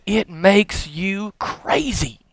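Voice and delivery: imitating a wife spelling it out slowly; measured verdict. Wife to talk-radio fan husband, slow deliberate verdict.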